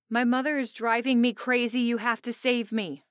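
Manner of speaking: a best friend begging to be rescued from her mother; exasperated bride-to-be pleading. A narrator's reading. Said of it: "she says in an exasperated voice".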